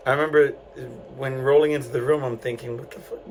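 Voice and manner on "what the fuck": a shocked whisper under the breath; whispered disbelief. Retold or imitated. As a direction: breathe it out, stunned, barely voiced. Inner thought on entering room.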